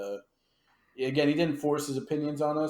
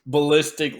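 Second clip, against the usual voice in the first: ordinary talk, nothing marked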